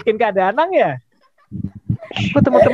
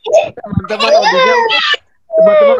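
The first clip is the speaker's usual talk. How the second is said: exasperated high call; 4 dB louder than usual. Acted. Host calls kids, exasperated.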